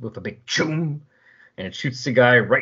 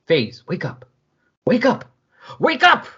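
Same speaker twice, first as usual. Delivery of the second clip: from calm to a high, loud cry; urgent building call; 10 semitones higher, 4 dB louder than usual. Acted. Comet calling to fallen Faze, building to a shout. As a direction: raise it each time until she wakes.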